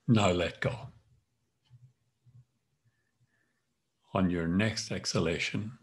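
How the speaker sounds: calm instructor guiding each tense and release; guided yoga nidra. Acted. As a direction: lead the body scan, firm on tighten, easing on let go. Leads tense-relax yoga nidra.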